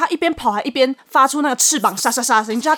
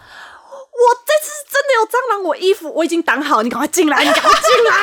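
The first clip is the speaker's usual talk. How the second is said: desperate plea; 9 semitones higher than usual. Retold or imitated. Daughter shielding herself, begging dad to come in, desperate. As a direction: plead with dad in despair. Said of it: "充满绝望欸".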